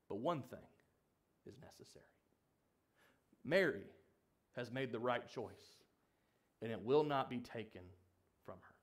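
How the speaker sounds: calm her worry gently; Jesus soothing a worried Martha; gentle rebuke. Acted.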